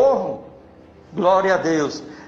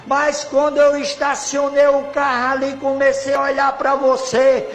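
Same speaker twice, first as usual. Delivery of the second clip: imitated crying shout; 3 semitones higher, 3 dB louder than usual. Drunk stranger runs up to the seated preacher, wailing shout. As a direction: act out a man shouting through tears, stretching the key words. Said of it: "Aí o homem veio gritando, chorando".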